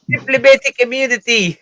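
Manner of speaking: high-pitched mock South African; South African accent. Co-host shows off his impression, high South African accent. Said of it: "South African there, right there".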